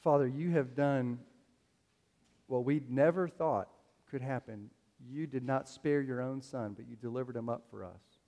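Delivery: soft, unhurried prayer voice. Real, not acted. Pastor praying over the church before communion, soft. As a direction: pray quietly and reverently.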